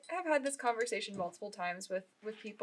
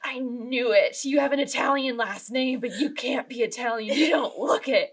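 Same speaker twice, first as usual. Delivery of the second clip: triumphant insistence; 2 semitones higher, 7 dB louder than usual. Retold or imitated. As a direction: imitate someone loudly sure they were right. Acts out professor's triumphant insistence.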